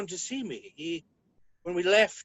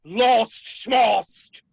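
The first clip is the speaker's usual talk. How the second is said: indignant scoff; 2 semitones higher, 8 dB louder than usual. Acted. Villain scoffs at being told he lost.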